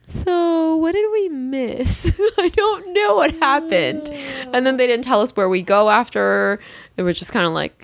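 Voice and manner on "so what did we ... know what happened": slow, high mock whine; mock-tearful confusion; plaintive bewilderment. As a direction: draw it out as if helplessly lost. Acted bewilderment after French explanation.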